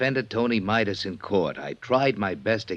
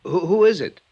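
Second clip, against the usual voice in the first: frightened, higher and louder call through the door; nervous call; 9 semitones higher, 8 dB louder than usual. Acted. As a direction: call out, voice jumping with fear. Frightened Engel calls to the door.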